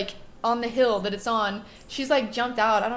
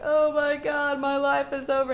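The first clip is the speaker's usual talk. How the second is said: higher, louder, crying-voiced retold despair; mock crying wail; 4 semitones higher, 3 dB louder than usual. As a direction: act out sobbing panic, pitched up. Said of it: "like, crying".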